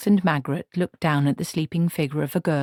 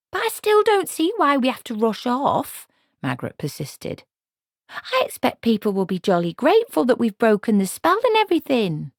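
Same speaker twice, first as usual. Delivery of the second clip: put-on higher, louder, earnest young woman; young Margaret protesting; 8 semitones higher, 4 dB louder than usual. A narrator's reading. Margaret protests to Granny, high and earnest. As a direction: plead like a puzzled girl.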